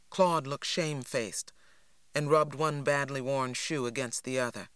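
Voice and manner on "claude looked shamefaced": plain reading voice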